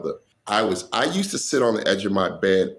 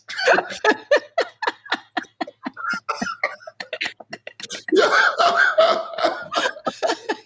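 big high laugh. Real, not acted. Comedian laughing hard at the boots joke, high burst. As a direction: crack up hard at the punchline.

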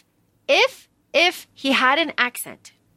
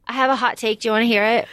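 ordinary talk

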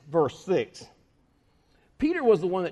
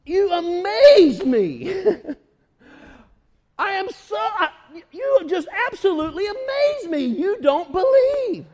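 very slow, loud, higher incredulous cry; amazed Jesus; 10 semitones higher, 5 dB louder, slower than usual. Retold or imitated. Jesus marveling at their unbelief, loud. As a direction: stretch out the disbelief. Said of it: "He was greatly surprised".

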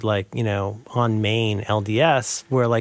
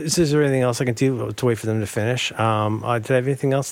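usual speaking voice

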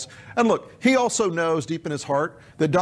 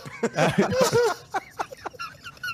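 long high giggle; tickled giggling; high laughing fit. Real, not acted. Laughing at the joke.

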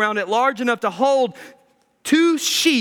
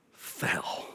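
whisper; 14 dB quieter than usual. Acted. Climax word whispered.

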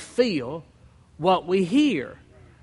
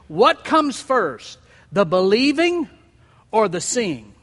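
slow and pointed; 3 dB louder than usual. Real, not acted. Preacher poses question.

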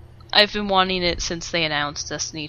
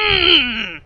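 frustrated groan; 5 semitones higher, 7 dB louder than usual. Real, not acted. Frustrated outburst at brother.

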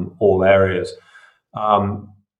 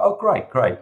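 much higher, eager "Oh, great, great"; mock delighted patient; 9 semitones higher than usual. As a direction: play the grateful patient, lightly hammed up. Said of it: "You go".

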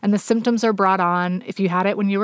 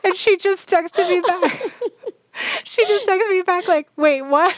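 laughing while talking; 10 semitones higher than usual. Real, not acted. Laughing through the story.

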